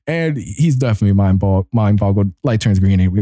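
usual speaking voice

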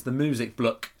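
"bloke" said the Norfolk way, short vowel; Norfolk accent. Shown as a demonstration. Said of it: "say it properly if you're from Norfolk".

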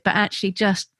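usual speaking voice

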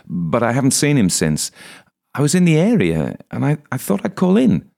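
flustered priest talking too fast to be believed; nervous blurt. Acted. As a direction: blurt the lie, voice rising in panic.

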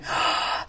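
soft acted gasp; sharp, voiceless intake of breath. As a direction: gasp as if taken aback. Acting out her touched reaction.

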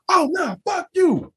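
high mock shout; mock angry woman. Mimicking the woman defying him, high mock shout. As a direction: ham up her defiant cry.

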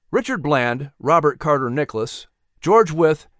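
usual speaking voice

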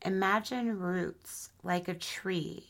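unhurried, soothing guide through breath and body; guided meditation. Acted. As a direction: ease them gently into relaxation, phrase by phrase.

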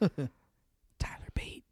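Tyler Bate whispered slowly; whispered reveal. Retold or imitated. Revealing who came out, whispered slowly. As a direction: breathe the name out slowly.

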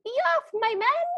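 high-pitched little gnome order; main gnome's command. Retold or imitated. Gnome dispatching his men, high imitated command. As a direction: send off the troops in a high, small voice. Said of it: "our main gnome goes".

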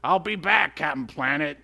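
cartoon baddie signing off, mock-menacing; mock villain exit line. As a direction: toss off the villain's farewell threat, hammed up. Villain's parting line retold.

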